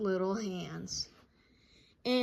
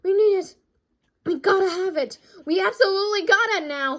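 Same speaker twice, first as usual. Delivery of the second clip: high, loud desperate begging; excited pleading; 9 semitones higher, 11 dB louder than usual. Acted. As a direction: beg like kids who can't wait. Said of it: "all excited and so out of breath".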